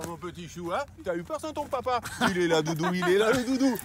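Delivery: put-on talking to a baby. Cooing to drone like baby.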